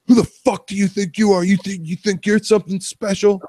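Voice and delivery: high, loud mock rage at the boss; hammed-up furious employee shouting back; imitating an angry yell. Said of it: "he loses it".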